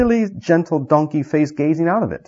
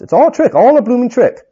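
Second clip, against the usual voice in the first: much higher, louder jeer; jeering dwarves; 11 semitones higher, 6 dB louder than usual. Acted. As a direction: scoff loudly as a crowd.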